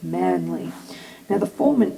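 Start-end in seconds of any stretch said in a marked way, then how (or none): 0.0-0.7 processed down into a manly rumble; pitch-shifted deep. Shown as a demonstration. Demoing the pitch knob, voice processed very low. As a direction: voice effect dropped way low. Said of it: "it makes it very".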